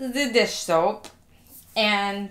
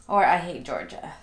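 ordinary talk

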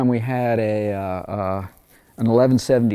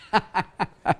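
high chuckle; 8 semitones higher, 4 dB quieter than usual. Real, not acted. Laughs at pun.

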